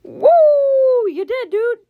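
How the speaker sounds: high-pitched gleeful whoop; squealing, happy cheer; happy excitement. Real, not acted. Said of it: "I sound happy that she's gonna die".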